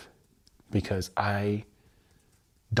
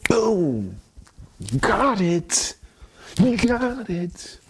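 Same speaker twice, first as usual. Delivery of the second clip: excited cheer; 10 semitones higher, 4 dB louder, much slower than usual. Real, not acted. Cheering himself on after release.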